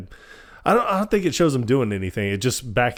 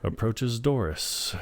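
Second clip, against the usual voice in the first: soft, slow deadpan; bored prediction; 7 dB quieter, slower than usual. Retold or imitated. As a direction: imitate calling events quietly before they happen. Imitates Phil predicting events, bored.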